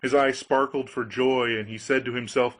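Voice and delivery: ordinary delivery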